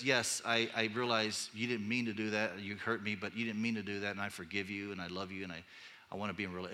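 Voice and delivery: soft, fading words of forgiveness; a hint of a tender forgiver; gentle forgiving. Quoting a gentle, forgiving person.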